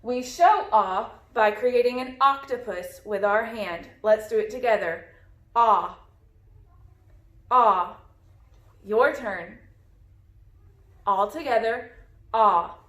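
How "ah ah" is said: an open, clear "Ah" for the letter O; short o sound. Shown as a demonstration. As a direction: model the short vowel "Ah" with an open mouth. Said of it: "The short sound for the letter O is ah".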